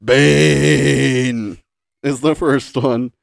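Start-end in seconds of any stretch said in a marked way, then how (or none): 0.0-1.6 mock Bane grunt. Quoting Bane's grunt.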